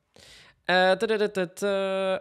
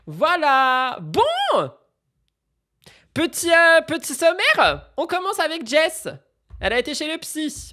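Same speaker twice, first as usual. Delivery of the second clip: high, loud announcer energy; much higher and louder, bubbly presenter; excited show-host intro; 10 semitones higher, 5 dB louder, slower than usual. Real, not acted. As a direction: hype up the summary like a host.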